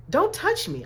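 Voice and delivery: put-on high, snappy little-kid protest; irritated child. Little girl warns strangers off, high and snappy. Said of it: "I used to be irritated, like".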